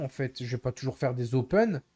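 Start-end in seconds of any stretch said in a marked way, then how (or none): none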